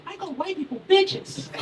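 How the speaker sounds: put-on high, slow little-kid voice; Tommy. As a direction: imitate an innocent first-grader stating a fact. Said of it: "He goes".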